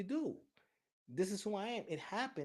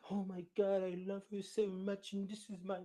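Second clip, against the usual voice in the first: mock doting dad; 6 semitones higher than usual. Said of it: "this is what society expects you to do".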